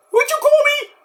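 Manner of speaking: high, fast, squeaky cartoon voice; squeaky voice offering Doritos; squeaky silly voice. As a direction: play a goofy squeaky character. Squeaky character objects.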